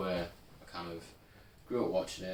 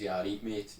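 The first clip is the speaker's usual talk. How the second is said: louder Newcastle catchphrase; Geordie accent; 2 semitones higher, 6 dB louder than usual. Acted. Friend offering his only line, Geordie accent. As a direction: say it in broad Geordie. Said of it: "all I can say is".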